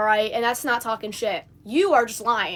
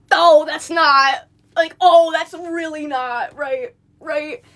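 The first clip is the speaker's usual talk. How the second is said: high, loud, drawn-out "oh"s; mock shock; 5 semitones higher, 7 dB louder, much slower than usual. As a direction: stammer in exaggerated shock, trailing into broken phrases. Mock reaction to accusation.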